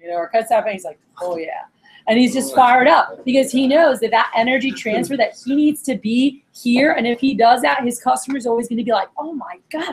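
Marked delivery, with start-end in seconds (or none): 1.2-1.6 low, hushed relish; mock-impressed murmur; imitated savoring. Imitating salesman relishing his demo. 9.2-9.9 high, soft gasp of amazement; imitated amazed customer.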